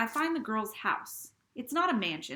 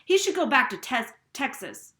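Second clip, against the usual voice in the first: spat out; 5 semitones higher, 10 dB louder than usual. Acted. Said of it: "Mike spits".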